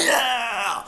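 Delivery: mock yell, high but held back in volume; stretched-out, half-voiced holler; imitated yell. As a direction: imitate a player hollering, without full volume. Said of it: "He's sitting there yelling".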